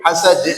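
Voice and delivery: HASAD with the d cut by a glottal stop; deadened qalqalah. Shown as a demonstration. Teacher shows what is forbidden, Hasad stopped dead. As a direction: chop Hasad short, no living echo. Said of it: "Nggak boleh".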